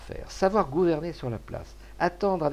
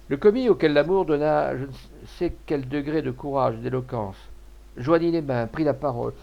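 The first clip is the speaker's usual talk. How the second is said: ordinary delivery, nothing marked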